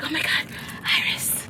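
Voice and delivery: soft, airy, all breath; breathy imitation. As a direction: breathe the words like a hushed hero. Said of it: "You know how he be breathy".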